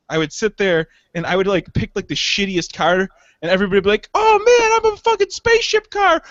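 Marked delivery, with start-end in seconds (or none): 4.1-6.2 put-on much higher, louder excited kid voice. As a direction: shout like a thrilled boy with a toy car. Kids voiced playing with cars.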